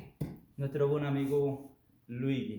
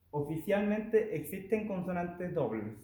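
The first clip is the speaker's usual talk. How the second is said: normal talking voice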